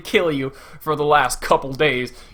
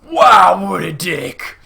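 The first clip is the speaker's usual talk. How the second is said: loud, higher burst of disbelief; appalled exclamation; 4 semitones higher, 8 dB louder, much slower than usual. Acted. Reviewer reacting to the abandoned girl, loud appalled exclamation. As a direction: blurt it out, scandalized.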